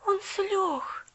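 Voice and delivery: put-on girl repeating the news, lost; bewildered. A narrator's reading. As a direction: repeat it stunned. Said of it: "растерянно повторила".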